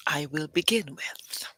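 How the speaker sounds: whispered aside; hushed trailing aside. Real, not acted. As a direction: drop to a whisper. Unvoiced aside.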